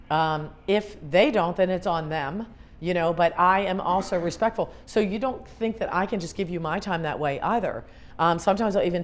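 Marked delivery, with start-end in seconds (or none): none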